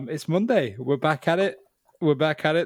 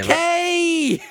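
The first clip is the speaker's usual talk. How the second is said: playful call; 5 dB louder than usual. Pretend. Calling out a name.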